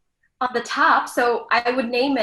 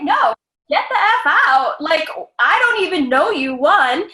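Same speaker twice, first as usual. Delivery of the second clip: louder and higher, laughing indignant rant; raised, exasperated get-out-of-my-house; indignant kick-out; 4 semitones higher, 6 dB louder than usual. Pretend. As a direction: throw the rude stranger out of your house. Throwing out a rude guest.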